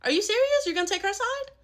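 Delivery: high-pitched disbelief; incredulous protest. Acted. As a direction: pitch shoots up in outrage. Hurt disbelief in sibling quarrel.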